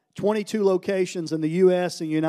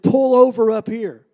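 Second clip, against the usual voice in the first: loud, raised call; 4 semitones higher, 7 dB louder than usual. Retold or imitated. Speaker flags down his van crew, calling out. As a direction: call out an order to the driver.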